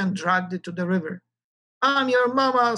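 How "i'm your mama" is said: lightly raised hen squawk on I'm your mama; a milder squawk, mama sung out evenly; a hint of the hen voice, only a little higher. A narrator's reading. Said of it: "squawked the intelligentsia".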